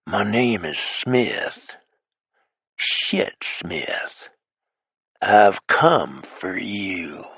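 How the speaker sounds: a slow, menacing villain's whisper; the stranger on the phone threatening the hero; slow, drawn-out menace; ugly low whisper. Acted. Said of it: "a low-down and ugly whisper".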